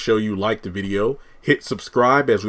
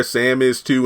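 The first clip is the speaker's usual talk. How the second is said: ordinary delivery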